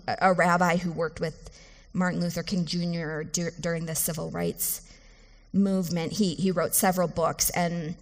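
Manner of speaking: ordinary talk, nothing marked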